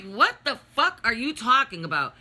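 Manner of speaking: retold indignant challenge; indignant retort. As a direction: retort in heated disbelief. Retold argument with brother.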